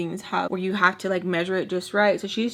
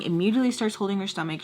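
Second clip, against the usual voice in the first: ordinary delivery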